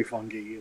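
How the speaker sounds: "fungi" said as FUNG-ghee; "fungi" with g as in geese; hard g, ee ending. Shown as a demonstration. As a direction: end "fungi" in a long ee after a hard g. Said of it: "a lot of people say fungi".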